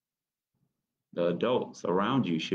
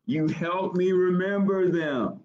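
higher character voice; 10 semitones higher than usual. Acted. Character line raised pitch.

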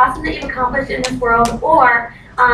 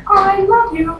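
high, louder burst of adoration; gushing; 9 semitones higher, 4 dB louder, slower than usual. Retold or imitated. New recruit meeting her shy mentor, gushing. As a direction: gush it, pitched way up.

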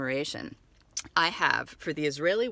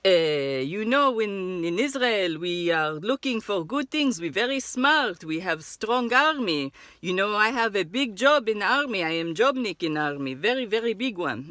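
put-on Israeli accent; 4 dB louder than usual. Seller boasting about army.

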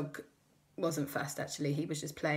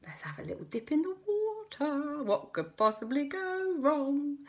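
sneaky; 9 semitones higher than usual. Acted. Kids plotting a dip.

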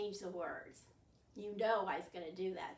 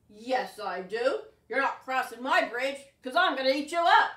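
louder, menacing troll voice; troll refusing to let the goat cross; gruff troll; 12 dB louder than usual. Acted. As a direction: block the way as a bossy troll. Troll threatens big goat.

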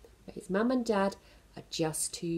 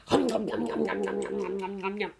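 monster munching. Acted. Monster eating noises.

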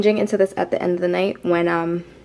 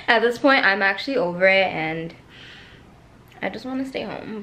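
normal talking voice

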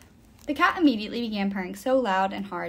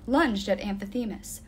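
ordinary delivery, nothing marked

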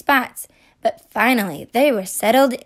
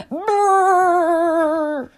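shivering brrr; 7 semitones higher than usual. Acted. Florida freezing.

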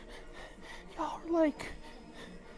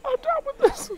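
crying laugh. Real, not acted. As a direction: laugh and sob at once, pitch cracking up high. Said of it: "I'm crying".

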